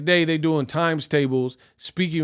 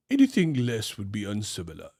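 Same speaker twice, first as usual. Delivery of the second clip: put-on a fussy aristocrat toddler; posh kid; 7 semitones lower, 7 dB quieter than usual. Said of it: "posh kid continued".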